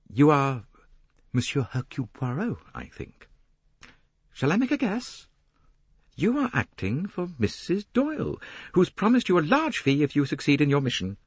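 put-on high-pitched young woman's voice; pointed, high guess at his errand; Jacqueline, knowing. Young woman guesses who hired the detective, knowing high voice.

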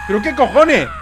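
high, loud disbelief; astonished shout. Real, not acted. Player reacting to a car explosion, astonished shout. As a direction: shout it, stunned.

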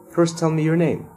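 high, sugary sweet request; sweetly. A narrator's reading. Teacher asking a nervous pupil for a name, sweetly. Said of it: "said sweetly".

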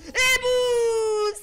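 high, ringing victory cry; triumphant shout. Real, not acted. Streamer celebrating a kill, triumphant shout. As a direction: slam it out like a finishing blow.

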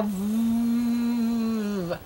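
long buzzing "vvv" for V; sustained phonics V; letter sound. Shown as a demonstration. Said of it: "I wanna hear you say it".

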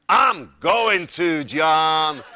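huge, slow, drawn-out Texan holler; Wayne. Retold or imitated. Wayne answers the guest's good wishes, slow booming Texan shout. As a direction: stretch every word out loud and proud. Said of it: "He said".

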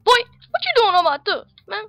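high, loud hammed-up outrage; mock turf threat. Player warning an intruder off his turf, mock-angry yell. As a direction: build to a mock yell, pitch jumping up.

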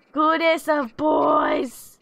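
high, loud, drawn-out praise; gushing dog praise. Real, not acted. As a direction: gush over a very good dog, stretching every word.

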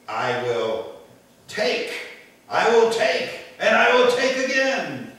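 high, loud greedy tax collector; greedy grabbing voice. Acted. Preacher voicing Zacchaeus's old greedy motto, high and loud. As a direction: grab at every word like a miser.

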